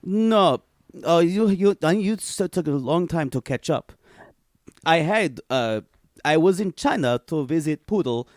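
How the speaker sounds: broken-English Olga, deadpan and boastful; Olga character. Acted. A made-up Olga telling the hosts about her trip, deadpan and broken. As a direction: play Olga as a blunt foreign traveler.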